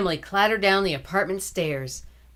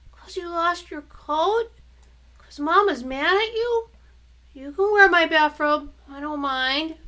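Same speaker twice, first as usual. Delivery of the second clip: coaxing little brother; 10 semitones higher, 4 dB louder than usual. Acted.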